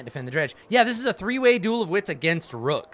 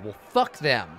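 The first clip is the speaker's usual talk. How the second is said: ordinary talk, nothing marked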